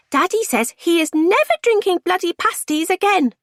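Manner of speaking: put-on high, loud little kid voice; high-pitched child announcing it. A narrator's reading. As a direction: pipe it up loudly like a child repeating Dad. Child repeats dad's swearing.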